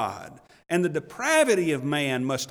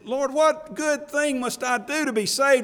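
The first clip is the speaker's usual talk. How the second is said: higher, pleading seeker's question; anxious seeker pleading; 7 semitones higher than usual. Retold or imitated. Burdened man kneeling before Jesus asks, pleading. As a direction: beg the Lord like a burdened man. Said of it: "He said".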